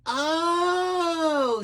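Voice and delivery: long, high "Oh" of dismay; drawn-out high wail of dread; alarmed oh. Acted. Dragon hears he is hunted.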